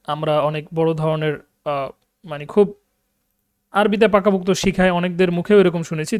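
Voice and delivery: ordinary talk, nothing marked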